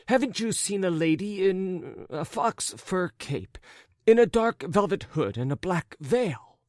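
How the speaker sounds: high, animated character voice full of fuss; flustered raccoon-coated gentleman. Acted. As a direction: play a fretful, wounded gentleman.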